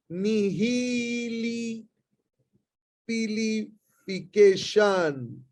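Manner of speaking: nihilipilification stretched very slowly, syllable by syllable; slow teacher demo of the long word; drawn-out pronunciation. Shown as a demonstration. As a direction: spell out nihilipilification slowly for students to copy. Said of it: "the word is".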